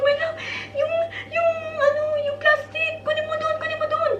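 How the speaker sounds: high, laughing hurried request; laughing retold plea. Wife laughing as she retells urging her husband to fetch the plastic. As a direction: giggle through the urgent ask to fetch it.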